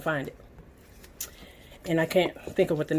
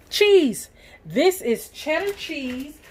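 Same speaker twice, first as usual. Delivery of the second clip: excited; 7 semitones higher, slower than usual. Real, not acted. Announcing the cheese.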